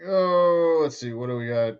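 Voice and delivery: normal talking voice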